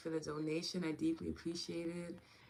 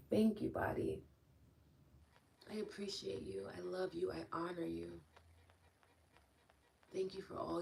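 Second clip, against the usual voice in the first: guided tapping script; 5 dB quieter than usual. Acted. Leader guiding viewers through a tapping close, softly. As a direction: lead the body thank-you gently.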